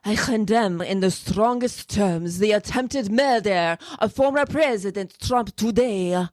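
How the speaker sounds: slow, put-on French accent. Host quoting a party leader for co-host, in a French accent. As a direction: read it out in a deliberate French accent. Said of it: "here's my French".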